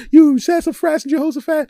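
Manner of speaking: high, loud hammed-up yelling; mock furious girlfriend. Girlfriend storming in, hammed-up shrill yelling. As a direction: scold him in a shrill mock rage. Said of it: "she used lots of curse words".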